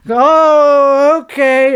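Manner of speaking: high, loud drawn-out reaction; mock dawning deference from a hotel clerk; impressed clerk. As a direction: stretch out a high, put-on "oh".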